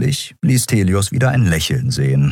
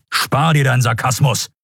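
higher-pitched, sharp reprimand; restrained bark; 6 semitones higher than usual. A narrator's reading. Admiral rebuking the prisoner's sarcasm, mildly barked. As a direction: snap at the prisoner without raising volume. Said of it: "bellte Thelios".

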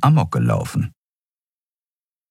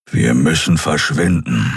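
low gruff grumble; grumbling; 4 semitones lower than usual. Acted. Keru decides they must leave, low grumble. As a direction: mutter it firmly. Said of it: "brummte er".

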